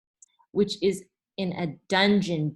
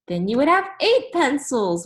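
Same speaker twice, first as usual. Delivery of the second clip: put-on kids in chorus; 7 semitones higher, 7 dB louder than usual. Class answers together.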